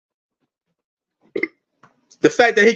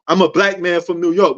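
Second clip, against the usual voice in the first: ordinary delivery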